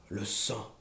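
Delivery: plus bas et beaucoup plus doux; chuchoté. A narrator's reading. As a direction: souffler le mot, menaçant. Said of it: "chuchotait-il". (le boucher chuchote le mot)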